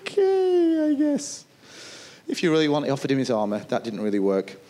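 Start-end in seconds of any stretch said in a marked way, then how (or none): 0.0-1.4 much higher, grudging shrug of a voice; a king giving in half-heartedly; reluctant Saul. Retold or imitated. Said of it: "Saul went".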